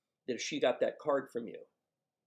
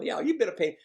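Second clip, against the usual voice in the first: amused mother; 9 semitones higher, 6 dB louder than usual. Retold or imitated. Voices mother's amused reply.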